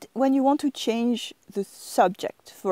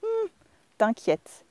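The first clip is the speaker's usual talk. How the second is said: reassuring dismissal; 8 semitones higher, slower than usual. Shown as a demonstration. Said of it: "You can hear it in my voice".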